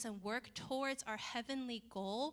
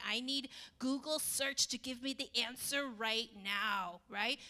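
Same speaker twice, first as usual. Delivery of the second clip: ordinary talk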